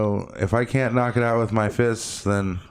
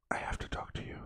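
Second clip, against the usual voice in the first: urgent unvoiced whisper; 17 dB quieter, faster than usual. Whispering his need to talk to strangers. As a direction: hiss it softly, desperate to chat. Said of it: "I'm just like".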